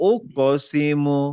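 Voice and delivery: ওকসিমু drawn out slowly with a bounced ক; slow qalqala demo. Shown as a demonstration. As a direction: stretch ওকসিমু and pop the ক. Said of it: "যেহেতু কফ আছে".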